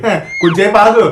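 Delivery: ordinary talk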